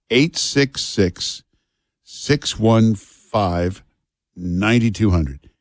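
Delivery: each digit spaced out, slowly; slow phone number. Acted. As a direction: spell out the number slowly for listeners.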